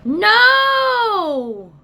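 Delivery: dismayed cry. Real, not acted. Palette breaks, papers everywhere.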